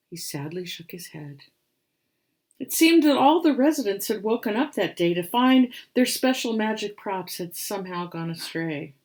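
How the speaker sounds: put-on lively read-aloud for children; rhyming story narration. A narrator's reading. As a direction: tell the rhyme with storybook bounce. Reads picture book aloud.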